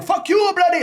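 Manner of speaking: put-on very high, loud comic Indian accent; mock Indian accent. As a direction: yell the insult in a fake Indian accent. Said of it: "we started going".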